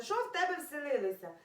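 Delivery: usual speaking voice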